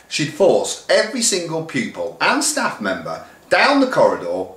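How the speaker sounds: ordinary delivery, nothing marked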